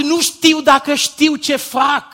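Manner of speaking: slow, high, loud cry of the prayer; preacher shouting a prayer for forgiveness; shouted plea. Acted. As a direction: cry it out over the crowd.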